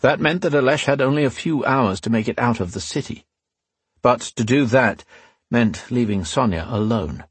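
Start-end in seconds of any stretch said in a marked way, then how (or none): none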